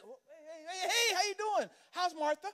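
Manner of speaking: high, breezy "hey, hey"; casual unbothered greeting. Retold or imitated. Guest of honor greeting the sinful woman, breezy and casual. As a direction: greet her lightly, unfazed.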